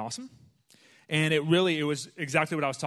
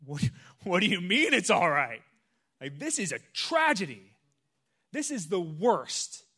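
incredulous disbelief; 5 semitones higher, 6 dB louder than usual. Retold or imitated. Retelling shock at friend's calm.

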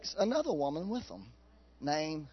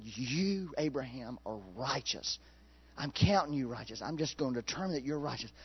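gentle divine declaration; 5 semitones lower, 9 dB quieter than usual. Acted. Preacher voices God declaring Abraham righteous.

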